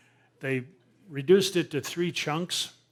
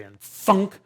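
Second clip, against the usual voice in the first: a sudden loud thunk, much higher; loud thunk sound; 6 semitones higher, 11 dB louder than usual. Retold or imitated. Telling how the bolt struck the gator, loud thunk. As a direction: punch out the thud of the bolt.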